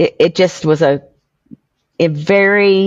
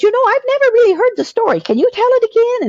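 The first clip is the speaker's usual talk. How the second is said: high, gentle voiced stepmom asking to hear the story. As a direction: pitch up to play the curious stepmom. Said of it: "she said".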